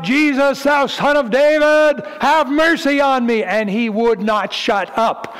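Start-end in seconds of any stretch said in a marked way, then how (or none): 0.0-3.4 high, loud desperate cry for mercy. Retold or imitated. Blind beggar calling out to Jesus passing by, loud high cry. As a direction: beg at the top of the voice, pitched high. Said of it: "And he cried out".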